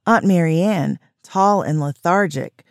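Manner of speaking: plain reading voice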